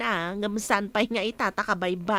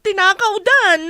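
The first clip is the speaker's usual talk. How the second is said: breaking into tears, higher and louder; crying; 7 semitones higher, 9 dB louder than usual. Acted. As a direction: sob it out, upset at the theft.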